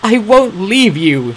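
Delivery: loud, slow refusal; protesting. A narrator's reading. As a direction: stand your ground. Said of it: "she protested".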